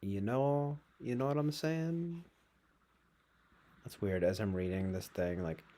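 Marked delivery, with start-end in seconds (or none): 0.0-2.2 loose Scottish lilt, raised pitch; a hint of put-on Scottish; Scottish accent. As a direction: try a rough Scottish accent, voice climbing. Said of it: "I was doing kind of a Scottish accent".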